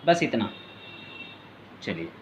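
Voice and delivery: ordinary talk, nothing marked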